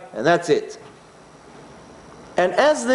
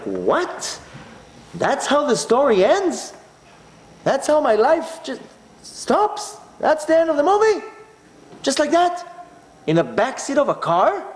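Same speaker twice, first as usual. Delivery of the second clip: incredulous; 8 semitones higher than usual. Retold or imitated. Disbelief at own death.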